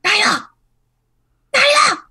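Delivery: high, urgent stage-whispered calls; hiss-shout the name down the hall; whisper yelling. Acted. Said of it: "really obviously whisper shouting".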